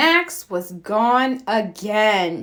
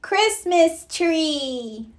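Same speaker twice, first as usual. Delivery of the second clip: little Max; 7 semitones higher, slower than usual. A narrator's reading.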